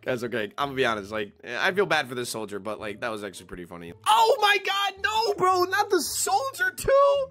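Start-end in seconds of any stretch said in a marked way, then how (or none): 4.1-7.3 high-pitched, wailing protest; over-the-top gamer outrage; shocked dismay. Real, not acted. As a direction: cry out in disbelief, voice pitched way up. Dismayed as soldier gets arrested.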